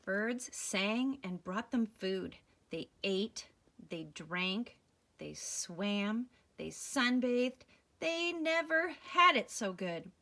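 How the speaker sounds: loud, slow, marveling read-aloud; excited story reading. Acted. Reading a picture book to a child, loud and awed. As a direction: paint the paradise with wonder.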